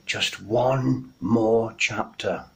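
soft, slow, sleepy pleading. Pretend. A bedtime reader bargaining with themselves, soft and slow.

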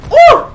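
very high, loud yelp; startled yelp of pain. Real, not acted. Player hit by an opponent, sharp high yelp. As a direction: yelp as the punch lands.

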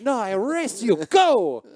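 put-on high, loud playful old-man Filipino accent; mock Filipino accent. Old neighbor playing tag with the son, mock Filipino accent. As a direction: bark the game rules as an old Filipino man. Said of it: "I don't have a Filipino accent".

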